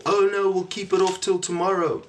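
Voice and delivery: high-pitched excuse-maker's voice; mock procrastinator. Host mimics people putting things off till tomorrow, high mock voice.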